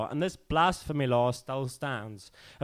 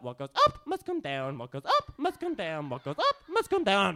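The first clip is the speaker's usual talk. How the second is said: swooping rise on up, drop on down; spoken-word performance; 7 semitones higher than usual. Acted. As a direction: let the pitch follow the words up and down. Repeated swooping line in poem.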